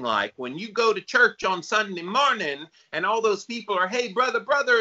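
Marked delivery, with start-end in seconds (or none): 3.9-4.8 much higher, sugary churchgoer greeting; fake cheery greeting. Host mimics churchgoers greeting each other, high fake cheer. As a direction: mimic a beaming fake-happy congregant.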